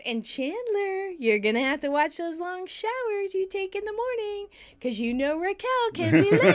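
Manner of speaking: high, sing-song put-on voice; hammed-up strange cooing warning; weird voice. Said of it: "in a very weird voice".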